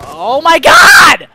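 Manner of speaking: slow, drawn-out high scream; explode, stretching out the last word; furious shout. Real, not acted. Outburst after missing a shot.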